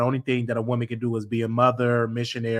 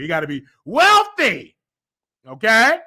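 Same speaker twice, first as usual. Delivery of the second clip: higher and louder, teasing insistence; playful demand; 12 semitones higher, 7 dB louder than usual. Pretend. Joking to a suitor, rising playful shout. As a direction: lay down the rule, building to a shout.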